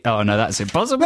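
ordinary talk

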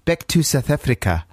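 lightly put-on South African accent; phonetic South African accent. Shown as a demonstration. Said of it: "you're saying it with a slight".

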